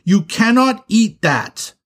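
high, loud warning; stern, alarmed shout; yelling. Acted. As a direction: yell a drawn-out, forceful ban. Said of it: "yelled at him".

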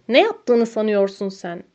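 ordinary delivery, nothing marked

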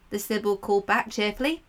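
ordinary delivery

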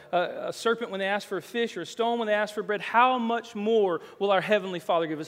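preacher pressing the point home; impassioned preaching. Real, not acted. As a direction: drive each question harder, building. Preaches at raised force.

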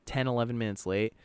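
normal talking voice

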